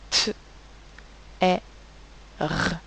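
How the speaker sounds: "T, E, R" as isolated sounds: t, è, rrr; speech sounds of "T, E, R", not letter names. Shown as a demonstration. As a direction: sound out "T, E, R" phonetically with long gaps. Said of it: "J'entends donc trois sons".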